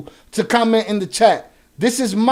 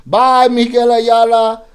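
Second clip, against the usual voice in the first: 1 mocking goodbye; 2 semitones higher, 7 dB louder, slower than usual. Real, not acted. Streamer kicking out a chatter.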